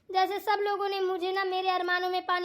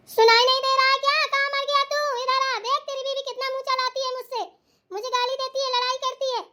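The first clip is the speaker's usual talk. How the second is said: चिल्लाती शिकायती सास; 6 semitones higher than usual. Acted. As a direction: call the son over, then complain in a high harangue. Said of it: "क्यों चिल्ला रही हो". (ऊँचे सुर में बेटे से बहू की शिकायत)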